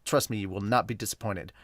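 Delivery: usual speaking voice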